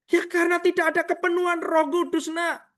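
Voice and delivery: put-on high-pitched reply to a child; lightly acted divine reply; God's answer. Acts out God's answer to him.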